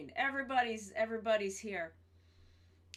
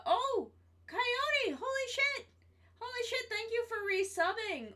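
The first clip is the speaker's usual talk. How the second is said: excited squeal; 12 semitones higher than usual. Real, not acted. Resub alert from a viewer.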